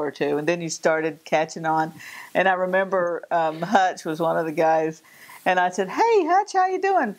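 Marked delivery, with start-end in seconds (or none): 5.9-7.1 high bright hello; cheery high greeting. Retold or imitated. Greeting visiting fiddler Hutch, high and cheery.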